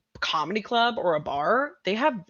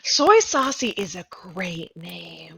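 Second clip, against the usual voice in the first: whispering; slower than usual. Real, not acted. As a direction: whisper it admiringly. Whispered praise of the name.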